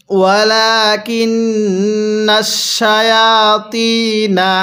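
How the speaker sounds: slow chanted ওয়ালাকিন্নাশ with a long humming "nn"; ghunnah recitation. Shown as a demonstration. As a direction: hold the nun in the nose, level and slow. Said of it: "এই নুনের মধ্যে আমরা গুনাহ করব". (নুনে গুন্নাহ করে ধীরে তিলাওয়াত)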